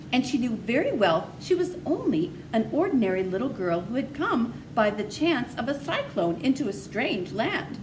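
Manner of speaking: ordinary delivery, nothing marked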